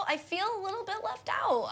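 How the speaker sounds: ordinary delivery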